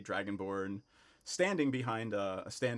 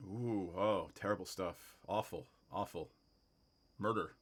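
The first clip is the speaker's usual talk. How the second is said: low, softened dismay; grave shopkeeper; 4 semitones lower, 3 dB quieter than usual. Acted. Shopkeeper recalls the manor murders, low and grave. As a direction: lower the voice over grim news.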